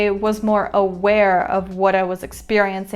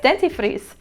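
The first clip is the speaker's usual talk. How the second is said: put-on Spanish-accented repeat of one word; 3 semitones higher than usual. Checking how she said the word yesterday, Spanish accent. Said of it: "said it in a Spanish accent".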